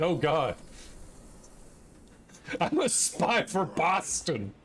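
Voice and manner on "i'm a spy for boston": put-on a slow, half-hearted Boston accent; bad Boston accent. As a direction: attempt a Boston accent and fail on purpose. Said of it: "I can't do the accent".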